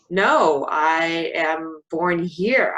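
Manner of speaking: loud, slow, emphatic "No"; firm correction. Retold or imitated. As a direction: set her straight, word by word. Her firm retort.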